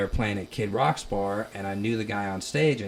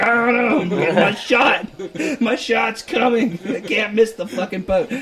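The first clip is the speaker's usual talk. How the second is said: frantic; 14 semitones higher, 10 dB louder than usual. Retold or imitated. Frantic about his big chance.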